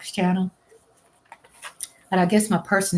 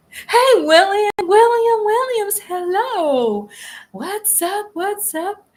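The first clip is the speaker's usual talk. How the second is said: excited greeting; 13 semitones higher, 5 dB louder, slower than usual. Real, not acted. Greeting a viewer in chat.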